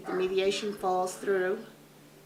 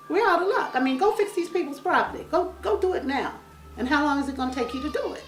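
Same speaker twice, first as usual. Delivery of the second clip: worked up, higher; 7 semitones higher, 3 dB louder, faster than usual. Real, not acted. Heated complaint about court.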